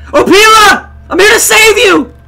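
high, loud rescue shout; heroic calling out. Pretend. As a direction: holler to her like a hammy rescuer. Heroic call to game character.

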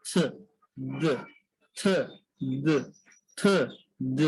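short isolated থ, then voiced দ, alternating; থ as pure air, দ with voice, pauses between; demonstrating TH sounds. Shown as a demonstration. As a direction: model থ unvoiced and দ voiced, one at a time. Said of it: "ইয়াটা উচ্চারণটা হবে".

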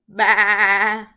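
very loud, drawn-out baa; sheep bleat. Shown as a demonstration. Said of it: "what do sheep say".

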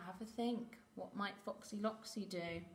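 plain reading voice

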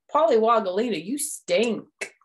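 loud disgust. Acted. Froggy disgusted.

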